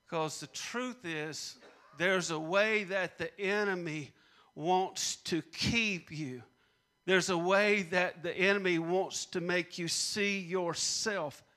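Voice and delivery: ordinary talk, nothing marked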